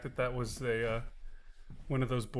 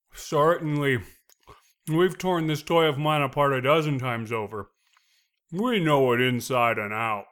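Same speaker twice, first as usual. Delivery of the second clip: loud, steady line; 2 semitones higher, 9 dB louder than usual. Acted. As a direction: project the line loudly. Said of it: "three different ways".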